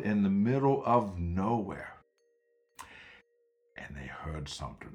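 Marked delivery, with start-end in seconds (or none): 3.8-4.9 whispered, fast; suspense whisper. A narrator's reading. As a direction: hush it ominously.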